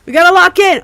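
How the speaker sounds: high, loud rallying cry; urgent yell. Real, not acted. Player urging the team to lock in, urgent yell. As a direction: shout it to fire up the squad.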